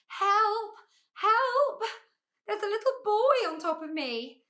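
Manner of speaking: high, loud panicky calls; shouting for help. A narrator's reading. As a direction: cry out for rescue. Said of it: "lay there shouting".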